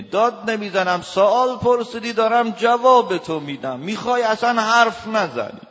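loud, high, heated denial; husband yelling that he isn't yelling; shouting husband. Acted. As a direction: bellow back at the wife while claiming not to yell.

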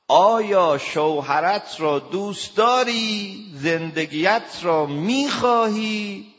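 loud, high, slow and insistent; emphatic shout. Retold or imitated. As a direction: hammer the question home, each word pressed. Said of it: "شما داد نزن".

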